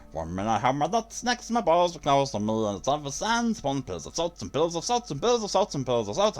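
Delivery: high, swooping creature voice; Smeagol. Acted. Streamer reading a viewer's parody lyrics as a creature, Smeagol voice. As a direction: recite it in a high, theatrical creature lilt. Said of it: "we can't hear Smeagol sing it".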